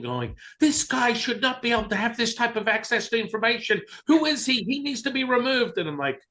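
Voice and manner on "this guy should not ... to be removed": much higher, wailing, outraged; imitating the psychologist bawling in protest; mock bawling. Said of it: "she starts bawling".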